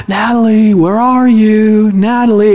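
drawn-out seeker's call; playful calling. Retold or imitated. Dad searching house for hiding daughter, calling out. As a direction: call out slowly, stretching the words.

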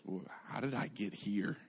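hushed inner monologue, very soft; thinking to himself. Retold or imitated. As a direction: murmur it privately, as if lost in thought. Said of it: "he's just thinking to himself".

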